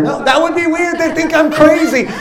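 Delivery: high-pitched comic protest; hammed-up worried objection; arguing with God. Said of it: "I was having an argument with God".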